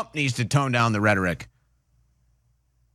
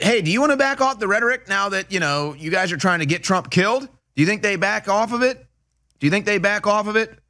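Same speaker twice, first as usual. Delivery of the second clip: mock reporter question; 7 semitones higher, faster than usual. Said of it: "It's like".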